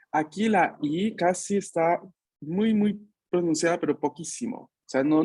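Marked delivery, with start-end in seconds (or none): none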